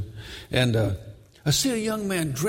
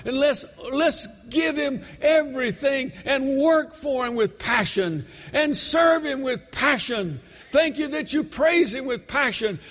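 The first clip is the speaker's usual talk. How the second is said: impassioned preaching; 10 semitones higher, slower than usual. Real, not acted. Preacher urging the church to serve God with passion, fervent shout. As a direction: drive it home to the congregation, drawn out and high.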